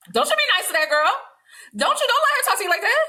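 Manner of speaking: very high, fast and loud scolding; high fast protective wife scolding. Pretend. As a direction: snap at him to stop being nice. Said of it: "I be wishing I could be in the room and be like".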